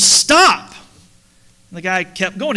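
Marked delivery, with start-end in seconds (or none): 0.0-0.7 loud high yell at a truck; worker's shout. Retold or imitated. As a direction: bellow Stop at the wrong-way driver. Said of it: "the construction worker went out there and he goes".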